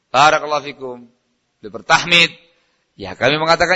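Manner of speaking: usual speaking voice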